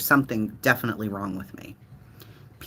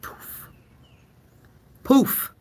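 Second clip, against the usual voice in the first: dramatic poof; 12 semitones higher than usual. Acted. Dramatic vanishing sound word.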